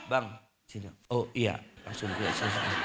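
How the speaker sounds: soft, low placating voice; soothing wife. Acted. Wife calling her angry husband over to coffee, hushed and placating. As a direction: coax the husband quietly, dropped low and soft.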